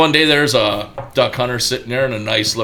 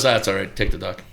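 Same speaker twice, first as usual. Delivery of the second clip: low, soft, offhand punchline; casual backing out; 7 semitones lower, 5 dB quieter than usual. Acted. Farmer gives up his turn at kicking, low and offhand. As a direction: drop it quietly, as if it hardly matters.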